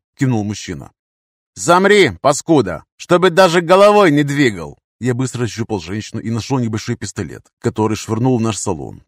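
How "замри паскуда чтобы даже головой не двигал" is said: loud, high order to freeze; harsh raised-voice threat; angry command. Acted. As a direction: yell at him not to move. Gunman orders man to freeze.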